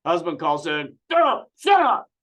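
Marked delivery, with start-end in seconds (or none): 1.1-2.1 high, loud shouted orders; drill sergeant. Retold or imitated. Host yelling at the complaining husband like a drill sergeant. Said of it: "He goes drill sergeant on him".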